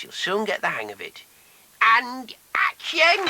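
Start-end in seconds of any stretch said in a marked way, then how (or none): 1.8-3.2 drawn-out showbiz call. Acted. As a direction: announce the start grandly. Director calls action.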